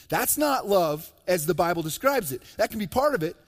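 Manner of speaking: ordinary talk, nothing marked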